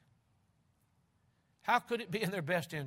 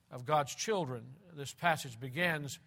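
ordinary talk

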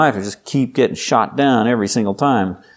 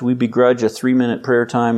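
normal talking voice

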